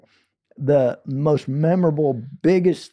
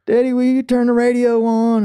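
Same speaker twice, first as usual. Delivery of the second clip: put-on kid asking; 9 semitones higher, 3 dB louder than usual. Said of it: "was like".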